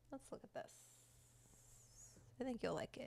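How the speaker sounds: unvoiced hush to the pet; whisper. Real, not acted. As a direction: whisper it, don't voice it.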